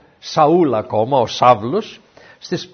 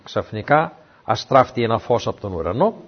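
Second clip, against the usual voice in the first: normal talking voice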